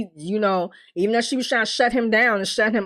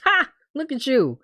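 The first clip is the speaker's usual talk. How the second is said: teasing jibe with a mocking "ha"; joking taunt; 3 semitones lower than usual. Visitor mocking do-gooder now in jail, joking. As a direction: imitate someone gleefully ribbing a friend. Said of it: "Almost like a joke".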